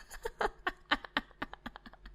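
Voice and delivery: breathy unvoiced villain laugh. Imperial admiral mocking the rebels, breathy villain laugh. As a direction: gloat with a menacing laugh.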